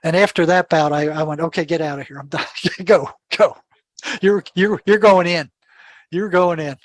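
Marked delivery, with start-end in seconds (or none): 1.4-6.8 urgent sendoff. Retold or imitated. Sends the patient out, louder.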